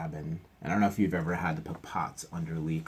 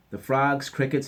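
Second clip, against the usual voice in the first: ordinary delivery, nothing marked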